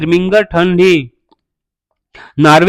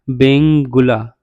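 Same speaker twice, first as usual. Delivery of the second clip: Benguela drawn out slowly; slow dictation; 4 semitones lower, much slower than usual. Shown as a demonstration. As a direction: stretch out Benguela for note-takers. Repeats the term for students.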